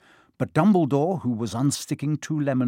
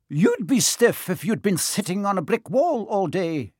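higher, crisp stern Scottish teacher; a prickly old witch caught out, higher; startled stern witch, pitched up; prim Professor McGonagall; 7 semitones higher than usual. Acted. McGonagall retorts.

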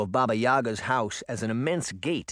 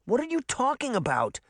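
demanding; 12 semitones higher than usual. A narrator's reading. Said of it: "Sabrina demanded".

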